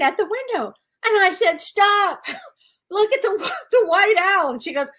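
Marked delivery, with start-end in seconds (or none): 1.6-4.5 high, loud and giggling through the words; laughing excitedly. Retold or imitated. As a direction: blurt it out while cracking up. Retelling seeing the owl while high.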